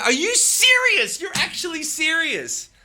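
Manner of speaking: high, slow, stunned disbelief; can't believe what he's reading; a high, drawn-out gasp of disbelief; incredulous surprise. Real, not acted. Reading the bank refusal message.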